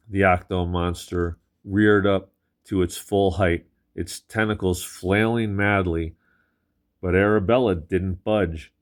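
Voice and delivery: ordinary delivery, nothing marked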